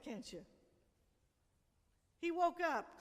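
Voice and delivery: usual speaking voice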